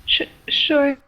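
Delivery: stammered, lightly tearful reply; mild sobbing. Acted. Sobbing Sally asked to speak, mildly tearful stammer. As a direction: catch on the first sound as if near tears. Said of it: "the sobbing Sally".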